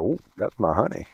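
low, soft and doting; fond adoring tone. Retold or imitated. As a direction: melt a little saying honey. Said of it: "You see the difference?".

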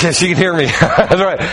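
real chuckle through the words; laughing. Real, not acted. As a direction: laugh at being overheard. Caught joking about wife, laughs.

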